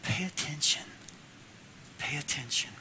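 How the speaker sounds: whispered then soft pay attention; hushed plea. Acted. Preacher voicing the daughter's hidden plea, hushed. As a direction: breathe it like a secret. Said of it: "What she was saying was".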